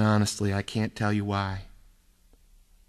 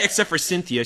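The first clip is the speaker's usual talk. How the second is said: plain reading voice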